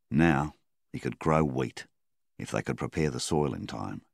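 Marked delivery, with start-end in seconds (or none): none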